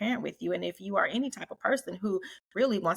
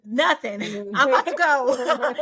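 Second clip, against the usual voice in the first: much louder and higher, laughing through it; mock panicked refusal; 5 semitones higher, 9 dB louder than usual. Conscience makes a husband refuse a bar stranger, laughing mock panic. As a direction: blurt it out laughing, already leaving. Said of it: "That conscience tell them".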